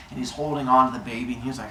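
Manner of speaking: ordinary delivery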